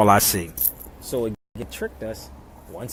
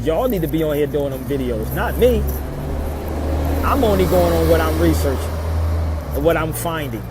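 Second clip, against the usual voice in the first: louder, insistent retelling of his reply; retold telling-off; 4 semitones higher, 8 dB louder than usual. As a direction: push it out like he's lecturing his brother again. I told him, I said.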